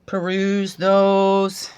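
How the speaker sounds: very slow, stretched invitation; slow, luxurious "peruse those"; slow drawn-out. Real, not acted. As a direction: draw out "Peruse" lingeringly. Invites closer look.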